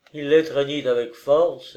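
ordinary delivery

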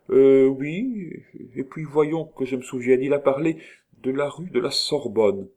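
high, hesitant princess recalling; false princess. A narrator's reading. False Nita answering the doctor's question, hesitant princess. Story character's voice.

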